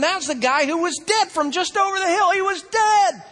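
high, excited exclamation; amazed onlookers. Retold or imitated. Crowd spotting the man who was dead, amazed.

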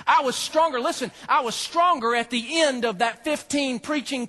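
fired-up pulpit preaching; full-force preaching. Acted. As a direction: preach it out to the crowd.

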